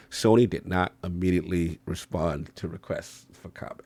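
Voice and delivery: dry news line, whispered; whispered aside. Real, not acted. Host reading the Sony story, whispered deadpan aside. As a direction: drop to a whisper for the punchline.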